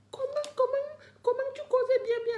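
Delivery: high, breaking into tears; crying. Real, not acted. To her followers about Fleur de Lys, crying out. Emotional rant about being dropped.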